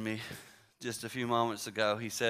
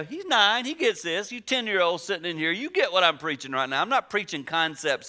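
higher, louder pulpit preaching; preaching at full force; 6 semitones higher, 4 dB louder than usual. Acted. Preacher urging children in the pews, full preaching force. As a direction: drive it home to the kids.

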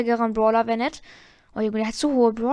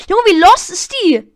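exasperated; 7 semitones higher, 10 dB louder than usual. Real, not acted. Game frustration.

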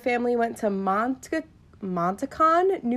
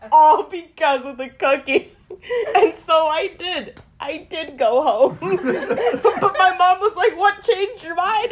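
laughing; 7 semitones higher, 5 dB louder, slower than usual. Real, not acted. Laughing while retelling.

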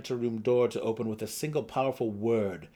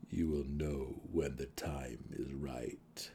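slow, soft voice speaking inside her head; low and quiet, like a thought planted in the mind; telepathic message; 8 semitones lower, 8 dB quieter, slower than usual. A narrator's reading. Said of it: "A second telepathic message".

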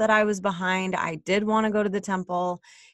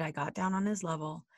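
ordinary talk, nothing marked

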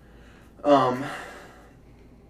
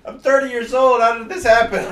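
raised, higher distress; distraught outcry; 7 semitones higher, 7 dB louder than usual. Acted. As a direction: complain in disbelief. Distraught victim.

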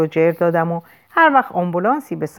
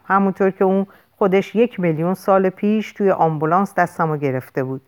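plain reading voice

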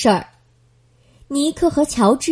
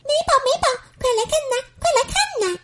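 very high chirpy bird voice; a little bird calling to its friend; 13 semitones higher, much faster than usual. Acted. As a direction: call out excitedly from the wall top. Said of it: "大声地喊道".

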